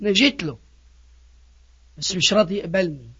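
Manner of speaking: soft, low acted grumble; subdued complaint. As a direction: complain too softly to be heard properly. Acted reluctant complaint, first take.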